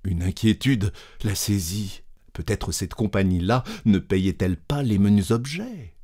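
ordinary delivery, nothing marked

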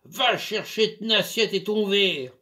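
loud, high angry ranting; yelling. Acted. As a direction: bawl her out. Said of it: "il hurlait".

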